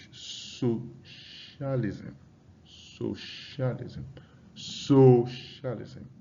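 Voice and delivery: "Socialism" very slowly, SO drawn out; stress on first syllable. Shown as a demonstration. Teacher quizzing students on stress, long SO in "Socialism". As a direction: stretch SO in "Socialism", then let the rest fall away. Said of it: "So the stress is on the what?".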